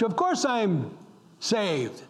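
ordinary talk, nothing marked

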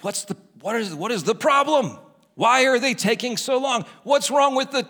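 rising, impatient mock rant; worked up. Preacher re-enacts waiting in a store line, getting worked up. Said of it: "you start getting worked up".

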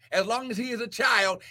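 fast offhand repeat; quick reread. Real, not acted. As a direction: skim back over the verse quickly.

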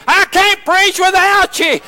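high, drawn-out plea; pleading. Retold or imitated. Preacher begging his church for support, pleading. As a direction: cry out to them, stretching each word. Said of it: "So I'll plead with them sometime".

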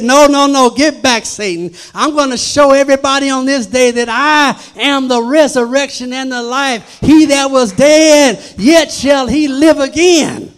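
loud preached rebuke of Satan; Jesus rebuking Satan at the tomb, loud; commanding Jesus. Retold or imitated. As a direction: thunder it out with authority. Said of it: "Jesus said".

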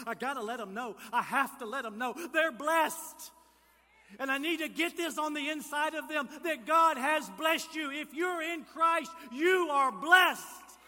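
high, urgent, building to a full shout; exuberant joy. Acted. Preacher as Paul pacing in prison, exuberant shout. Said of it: "over-the-top explanation with joy".

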